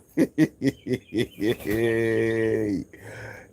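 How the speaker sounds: long, high, loud laugh; delighted laugh. Real, not acted. Laughing as the chickens run to the sound, delighted real laugh.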